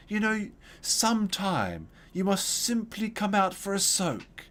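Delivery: high, slow, theatrical greeting; flamboyant old neighbor. Retold or imitated. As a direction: drawl it out like a flamboyant old man. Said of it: "the way the guy said it was so flamboyant".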